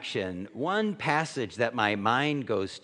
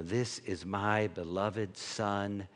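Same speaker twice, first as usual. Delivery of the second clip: much lower, soft and slow; voice from heaven; 6 semitones lower, 6 dB quieter, slower than usual. Retold or imitated. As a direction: speak slowly as God, tender and deep. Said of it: "the voice comes from heaven and says".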